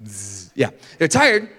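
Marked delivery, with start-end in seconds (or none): 0.1-0.5 very soft, low snooze noise; quiet pretend snore; mock snoring. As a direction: pretend to doze off. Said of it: "they're obviously tired".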